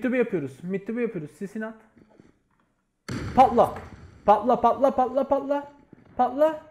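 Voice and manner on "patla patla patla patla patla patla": loud, pleading chant, rising higher; will the bomb to go off; tense urging. Real, not acted. Player urging the bomb to explode, loud and tense.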